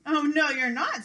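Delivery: loud, high troll shout. Acted. Troll refuses to let the goat pass, loud shout. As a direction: bark it back angrily. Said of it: "said the troll".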